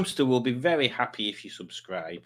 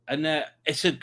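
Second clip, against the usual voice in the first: normal talking voice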